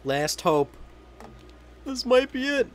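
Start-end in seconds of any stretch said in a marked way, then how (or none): 1.8-2.7 high, hopeful; rising excitement; excited. Real, not acted. Excited near the game's end.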